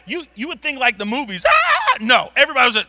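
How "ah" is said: high short scream like a film; mock movie scream. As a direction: shriek like a movie passenger.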